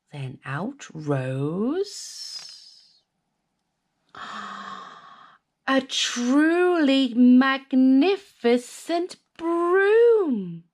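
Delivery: slow, awed reveal; wonder. A narrator's reading. Narrator revealing the broom, slow awe. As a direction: draw out the magic moment.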